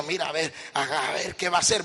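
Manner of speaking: low, hushed voice of nosy onlookers; murmullo de curiosos. Retold or imitated. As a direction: mimic a gossiping crowd, under the breath. Imita el murmullo de la multitud. (cuchicheo imitado de chismosos, grave y bajito)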